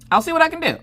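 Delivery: squeaky noncommittal promise; high, strained maybe-answer; high-pitched hedging. Retold or imitated. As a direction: pitch it way up, placating a child. Mom's noncommittal answer to daughter.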